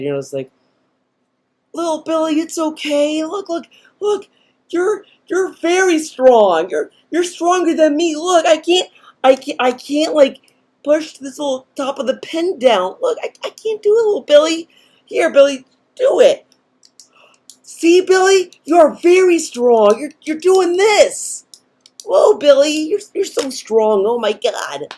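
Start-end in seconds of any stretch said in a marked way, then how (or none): 1.7-10.4 put-on high, babying voice for a crying kid; mock-soothe a little child in a high pitch; exaggerated parent cheering up a toddler; coddling parent. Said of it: "You're the parent. You come up to the kid". 10.8-24.9 put-on high, babying voice for a crying kid; mock-soothe a little child in a high pitch; exaggerated parent cheering up a toddler; coddling parent. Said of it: "You're the parent. You come up to the kid".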